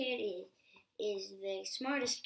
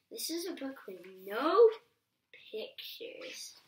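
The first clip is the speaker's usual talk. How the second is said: louder build, then pictures whispered; dramatic reveal; 2 semitones higher, 3 dB louder than usual. Acted. Reader teasing the child with the title. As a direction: hold the pause, then drop to a whisper.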